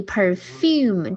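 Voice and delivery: per-FUME, the end syllable drawn out; stress on second syllable. Shown as a demonstration. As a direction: say "perfume" slowly, leaning on FUME. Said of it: "注意perfume重音在后面啊".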